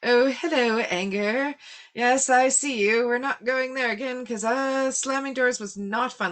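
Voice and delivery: high-pitched mock-friendly scolding; mock greeting to anger. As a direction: talk to anger like an unwanted visitor. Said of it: "I just turn around and go".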